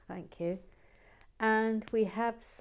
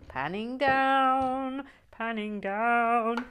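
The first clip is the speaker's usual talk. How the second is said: slow, drawn-out, higher and louder; announcing camera move; 4 semitones higher, 6 dB louder, much slower than usual. Pretend. Host narrating the camera panning, drawn-out and grand. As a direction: intone it like a dramatic film cue.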